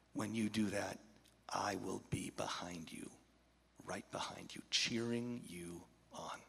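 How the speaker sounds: put-on soft reassuring whisper to the boy; tender whisper. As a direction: whisper it close, like a vow. Tender promise to Gabe.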